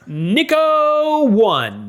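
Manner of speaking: big, high, slow reveal of the champion; hammed-up game-show reveal of first place; drawn-out winner announcement. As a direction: announce it like a prize-giving, stretching every syllable. Announcing fantasy league winner.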